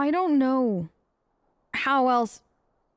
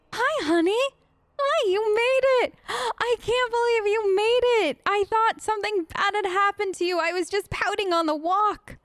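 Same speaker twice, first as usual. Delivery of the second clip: high, gushing pet-talk; overjoyed reunion; 6 semitones higher than usual. Real, not acted. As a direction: fuss over a dog you feared lost. Reunited with the dog.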